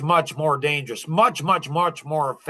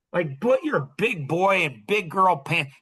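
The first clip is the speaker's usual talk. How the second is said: ordinary talk, nothing marked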